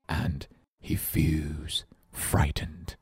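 slow, fading into a fearful whisper; spooky hush that drops to a whisper; frightened. Shown as a demonstration. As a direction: tell it slowly, voice shrinking with fear. Acts out scared bear example.

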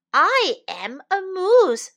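high, loud, drawn-out cry; insistent cry. A narrator's reading. Moose insisting to the deer, crying out. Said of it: "cried Morris".

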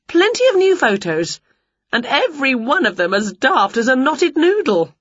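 high, lively storybook witch; pleased Winnie. Acted. Winnie looking over the new photos, pleased. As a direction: start high and bubbly.

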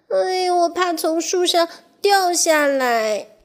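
high, sighing little mouse voice. Acted. Little mouse sighs to friends about falling, high and sighing. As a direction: let a small sigh carry the fear. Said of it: "小老鼠叹口气说".

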